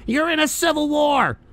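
a hint of a high mocking voice; mocking quote. Mocking Tim Pool's civil war talk, lightly voiced. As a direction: parrot him with scorn. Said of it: "this idiot has said".

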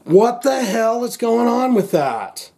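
high, slow, baffled and irritated; exasperated outburst. Real, not acted. Vlogger glaring at his misbehaving phone, exasperated. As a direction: blurt it out at the glitching phone. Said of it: "That's pissing me off".

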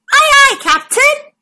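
chirpy eager deckhand; Macy answering the captain, high and keen; squeaky crew voice. Acted. Crew member replies.

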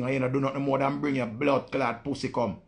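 ordinary talk, nothing marked

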